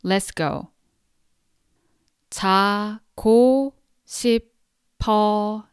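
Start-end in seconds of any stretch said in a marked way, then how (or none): 2.3-5.7 slow model phrase. Shown as a demonstration. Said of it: "slowly three times".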